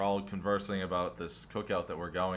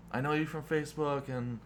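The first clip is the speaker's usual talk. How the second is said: higher-pitched imitation of gushing admirers; gushing fans; 7 semitones higher than usual. Online fans greeting the couple at the games, mock gushing. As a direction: gush like a starstruck follower.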